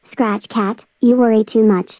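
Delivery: ordinary delivery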